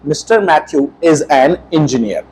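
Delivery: slow, deliberate dictation; slow model answer. Shown as a demonstration. Teacher dictates the corrected sentence slowly to students. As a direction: spell it out slowly for the class. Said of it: "हम क्या लिखेंगे".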